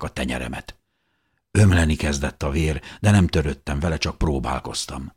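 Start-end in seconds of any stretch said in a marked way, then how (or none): none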